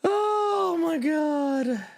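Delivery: very high, slow "oh my God"; high drawn-out gasp. Real, not acted. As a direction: stretch it out high in mock pity. Reacts to the sad super chat.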